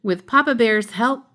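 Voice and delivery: ordinary delivery, nothing marked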